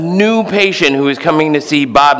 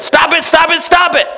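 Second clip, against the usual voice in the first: repeated shout. Retold or imitated. Therapist yelling the order three times at his patient. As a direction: yell it like an order. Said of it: "he says".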